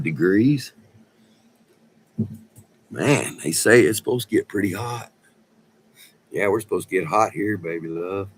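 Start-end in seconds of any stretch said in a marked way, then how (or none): none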